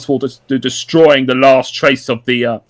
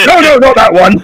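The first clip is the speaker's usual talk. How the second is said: laughing protest; 9 semitones higher, 8 dB louder than usual. Acted. Laughing correction of Knuckles.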